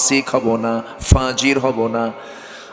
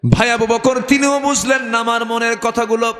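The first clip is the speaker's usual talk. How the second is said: retold complaint in high waz chant; high, intoned lament of the refused man; melodic preaching; 10 semitones higher than usual. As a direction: lift the grievance into a high sung tone.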